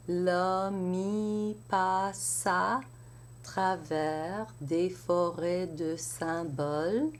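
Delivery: L'homme y passe à flowing together without breaks; linked recitation. Shown as a demonstration. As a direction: chain the words slowly. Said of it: "some enchaînements".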